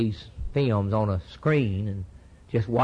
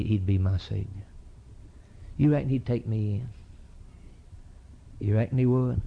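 ordinary talk, nothing marked

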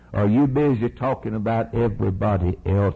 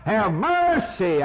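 pleading cry; 9 semitones higher, slower than usual. Acted. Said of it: "And he cried out".

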